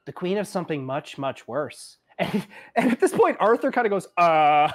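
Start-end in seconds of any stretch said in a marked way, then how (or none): 0.0-2.0 put-on a little girl correcting a grown-up; Evandra. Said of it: "She goes". 4.2-4.7 dumbfounded. Acted. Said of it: "like that face of".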